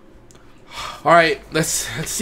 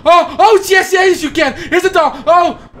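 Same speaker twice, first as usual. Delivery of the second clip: high, loud frantic babble; panicked yelling; 12 semitones higher, 5 dB louder, slower than usual. Real, not acted. Player frantically offering the creepy girl a doll, panicked yelling. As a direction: shriek it in a hurry to appease her.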